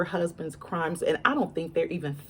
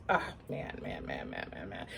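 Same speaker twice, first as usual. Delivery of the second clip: man man man, trailing into near silence, much softer; dismayed sigh; 11 semitones lower, 11 dB quieter than usual. Real, not acted. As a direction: let it sink away in dismay. Sighing in dismay.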